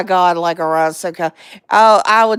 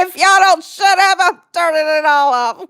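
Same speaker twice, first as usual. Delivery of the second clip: higher-pitched mock threat through amusement; lightly voiced scolding mother; 7 semitones higher, 3 dB louder than usual. Daughter imitating mother scolding her singing kids, mock threat. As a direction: imitate a fed-up mom, half-amused. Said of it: "And she'd say".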